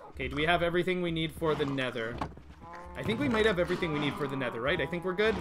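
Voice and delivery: ordinary delivery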